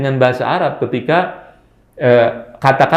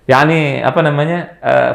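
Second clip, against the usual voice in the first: normal talking voice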